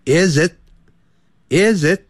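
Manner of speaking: very slow "Is it" with the t braked off short; stopped t. Shown as a demonstration. Teacher drilling students on braking the t, very slow. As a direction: say "Is it" slowly and stop dead on the t. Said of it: "刹车刹住".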